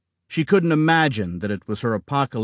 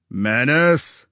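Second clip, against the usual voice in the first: a hint of a booming king; lightly thundered royal command; thundering tyrant king; 3 semitones higher than usual. A narrator's reading. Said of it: "the king thundered".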